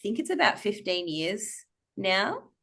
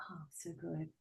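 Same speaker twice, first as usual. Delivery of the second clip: very soft, private relish; hushed little aside; murmured to self; 3 semitones lower, 22 dB quieter than usual. Retold or imitated. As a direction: savour it almost silently, to yourself.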